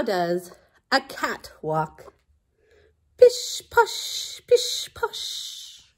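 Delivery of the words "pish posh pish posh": whispered, with long hushing sh sounds; stealthy cat whisper. Acted. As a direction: creep along like a cat in a whisper. Acting out how a cat walks.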